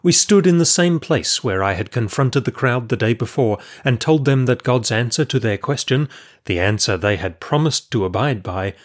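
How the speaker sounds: ordinary delivery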